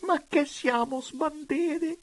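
high-pitched tearful questioning; wailing disbelief. A narrator's reading. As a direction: ask it through tears, high and plaintive. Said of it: "tra le lacrime".

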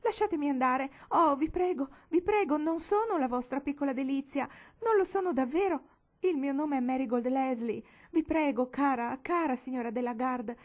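high, loud pleading, easing toward the end; desperate plea. A narrator's reading. Captive girl begging the lady to let her go, desperate. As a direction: beg urgently to be released. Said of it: "esclamò disperata".

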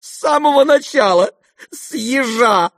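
very high, loud exclamation; crying out. A narrator's reading. Sister exclaims she knew all along, crying out. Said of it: "вскричала Аделаида".

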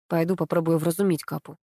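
usual speaking voice

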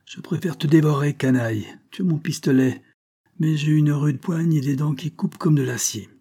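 cornered convict rages at the cop; furious threat. A narrator's reading. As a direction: threaten to tear him apart. Convict threatens to devour policeman.

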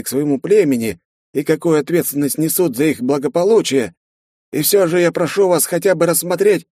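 high, courteous official voice; a smiling rector making an offer; genial rector. A narrator's reading.

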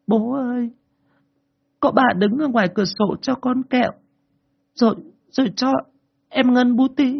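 put-on high small-girl voice, stammering; small child. A narrator's reading. Child answering father.

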